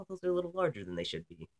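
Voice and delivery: hammed-up snide remark; mocking snooty woman. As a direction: rattle it off like a catty gossip. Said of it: "are just like".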